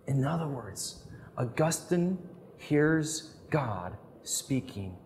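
ordinary talk

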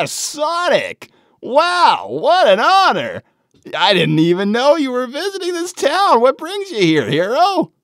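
high, excited gushing; starstruck excitement. Acted. Shy fan meeting Sonic at the door, high and starstruck. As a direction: gush like meeting your idol. Fan meets famous hero.

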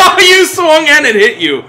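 high, loud, laughing exclamation; helpless laughing. Real, not acted. Player watches the other's botched swing, laughing hard. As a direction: crow it through real laughter.

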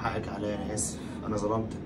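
very soft, low, subdued; hushed, low regretful murmur; contrite apology. Acted. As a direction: apologise quietly to your wife, ashamed. Husband apologises in shame.

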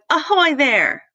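storybook pirate call; pirate shout. A narrator's reading. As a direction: hail a ship from the bow. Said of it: "like a pirate on the bow of a ship and shouted".

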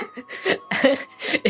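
soft breath-filled chuckle; real stifled laughter; laughing. Real, not acted. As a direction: laugh quietly at the scolding. Laughs at mother's scolding.

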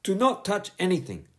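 high, firm schoolteacher voice; a strict teacher scolding a pupil; stern teacher. A narrator's reading. Teacher warning Bertie to sit down, stern and high. Miss Boot's voice.

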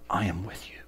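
hushed, tender whisper. Acted. God whispering to the sinner, hushed. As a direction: breathe the promise like a secret. God's promise to the rat.

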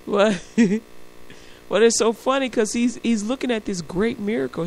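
ordinary delivery

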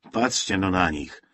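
plain reading voice

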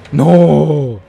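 long, loud cry of no; dismayed shout. Real, not acted. Player gets caught in the game, dismayed shout. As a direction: shout it out, drawn out.

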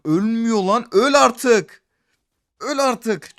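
loud, high shout at the screen; furious yell. Real, not acted. Gamer screaming at an enemy that won't die, furious.

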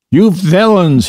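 high furious yell of You villains; angry shout. A narrator's reading. Rat shouts at the speeding motor car, high and furious. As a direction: shout it with fists shaking. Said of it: "he shouted".

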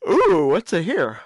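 ordinary delivery

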